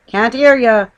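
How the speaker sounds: loud shouted call over the engine. Acted. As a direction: shout it back through the glass. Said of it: "he shouted".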